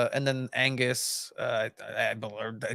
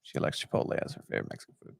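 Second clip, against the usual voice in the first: fast whisper, much softer; whispered aside; 10 dB quieter, much faster than usual. Real, not acted. Host tossing a side fact over the trailer, whispered fast. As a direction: slip the fact in under your breath, quickly.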